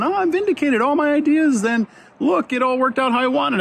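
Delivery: high, gloating sing-song voice; lightly mock the smug winner; smug vindicated voter. To listeners whose side won the election, a high mock gloat. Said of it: "thinking about that and going".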